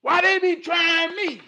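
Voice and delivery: loud, high-pitched exasperated cry; exasperated outburst. Acted. Man complaining that people keep trying him, exasperated outburst.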